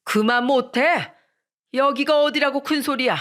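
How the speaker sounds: restrained shout of indignation; father shouting. A narrator's reading. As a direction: cut them off sternly. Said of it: "소리치며 말씀하셨습니다".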